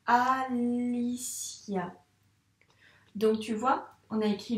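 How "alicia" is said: very slow, drawn-out "Alicia" matched to the pen; a teacher writing along with the pupil; dictated while writing. Shown as a demonstration. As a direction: stretch it out as you write each letter. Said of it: "À toi d'écrire en même temps".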